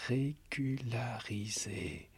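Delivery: chuchoté lentement, avec amertume. A narrator's reading. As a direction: souffler le mot comme une insulte. Mot de l'insulte repris. (murmuré, lent et amer)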